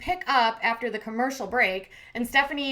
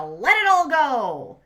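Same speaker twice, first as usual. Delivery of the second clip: sudden louder, drawn-out mock exasperation; exasperated outburst; 3 semitones higher, 7 dB louder, slower than usual. Retelling girlfriend listing grievances, exasperated outburst. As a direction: imitate throwing it all up in frustration.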